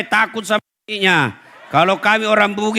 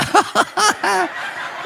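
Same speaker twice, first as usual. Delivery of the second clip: high laugh; 11 semitones higher than usual. Real, not acted. Laughs at own joke.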